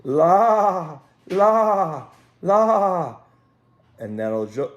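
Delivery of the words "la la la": high, loud sing-song call; lilting herding call; shepherd's call. Acted. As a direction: call the sheep to the edge of the pasture. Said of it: "he called".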